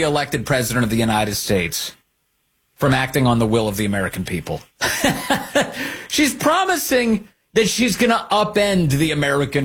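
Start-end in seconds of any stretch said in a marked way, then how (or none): 4.8-6.0 high, voiceless laughter; real laugh. Real, not acted. Host reacting to candidate's stated goal, laughing. 6.1-9.6 high-pitched, laugh-filled words; laughing while talking. Real, not acted. Host mocking candidate's promise to voters, laughing.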